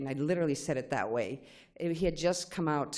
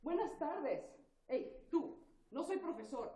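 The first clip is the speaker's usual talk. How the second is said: higher, softer mock official; reenacted meeting voice; 10 semitones higher, 9 dB quieter than usual. Replaying a meeting for Phil, in a higher, softer mock voice. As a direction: act out the tense meeting in a put-on higher voice. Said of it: "So, watch closely".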